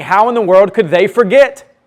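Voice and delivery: incredulous reader. Acted. Preacher voicing readers puzzled at Israel forgetting, incredulous. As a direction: voice the reader's baffled question. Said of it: "we read this and we think".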